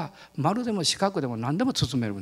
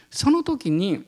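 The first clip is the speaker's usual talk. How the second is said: normal talking voice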